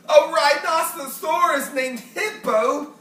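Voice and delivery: slow, delighted exclamation; Fluffy howling with laughter at the name; crying out with laughter. A narrator's reading. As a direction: savour the joke through laughter. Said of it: "Fluffy cried".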